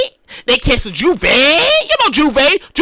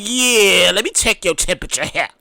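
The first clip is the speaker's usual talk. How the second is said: lower, softer, suspicious cousin checking guests; wary cousin; 7 semitones lower, 4 dB quieter than usual. Retold or imitated. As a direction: play the cautious host eyeing a visitor. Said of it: "he's giving you the side eye like".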